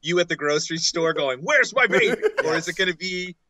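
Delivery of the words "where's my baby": high-pitched mock maternal wail; mock angry mother. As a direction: call out high like a pretend distraught mother. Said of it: "play as an angry mother".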